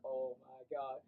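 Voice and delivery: low, soft, dazed groan; understated morning-after dismay; subdued realization. Retold or imitated. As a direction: drop it low and hushed as it sinks in. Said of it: "way more, like, lucid".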